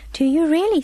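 put-on lifted, eager child's question. A narrator's reading. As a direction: ask it with keen, hopeful surprise. Child excitedly questions neighbour.

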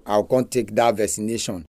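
ordinary delivery

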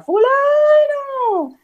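long, high drawn-out call across the yard; calling out loud. Retold or imitated. Dominicans calling to someone from afar, long high holler. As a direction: holler a name far off, stretched slow. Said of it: "nos gusta mucho vocear".

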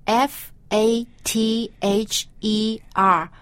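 slow letter-by-letter F A T H E R; spelling it out. Shown as a demonstration. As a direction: spell F A T H E R slowly, each letter apart. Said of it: "spell it".

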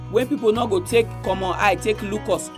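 normal talking voice

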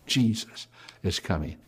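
hushed, breathy secret; confiding whisper to the congregation. Real, not acted. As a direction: lean in and whisper it. Quiet aside in sermon.

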